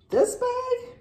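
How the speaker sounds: thrilled emphasis, pitch leaping up; excited squeal. Real, not acted. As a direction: squeal THIS BAG with delight. Repeating words to show off bag.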